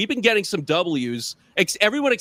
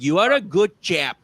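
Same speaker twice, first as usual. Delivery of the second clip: higher, louder Indian-English grandma; Indian grandma accent; 5 semitones higher, 5 dB louder than usual. Retold or imitated. Comedian quoting his grandma's praise, Indian accent. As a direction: put on grandma's British-tinged Indian accent. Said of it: "the Indian accent is just, like, a bad British accent".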